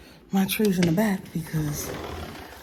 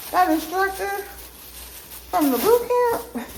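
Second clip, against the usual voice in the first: much higher, slowed, giddy and gushing; 11 semitones higher, slower than usual. Real, not acted. Telling viewers about the boot camp instructor, giddy and high. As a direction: squeal it out like a crush.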